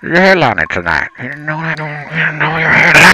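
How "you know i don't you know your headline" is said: higher, hard-to-make-out mic voice; muffled mic talk. Pretend. Co-host teasing about the headline, garbled and high. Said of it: "can't hear you when you do that".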